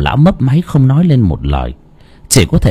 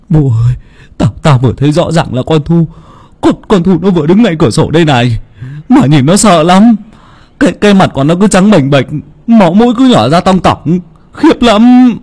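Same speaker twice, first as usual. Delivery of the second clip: high, loud, fast panicked telling; terrified; 7 semitones higher, 4 dB louder than usual. Acted. Shaken father tells his son about the ghost, high panicked rush. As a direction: blurt out the horror you just saw.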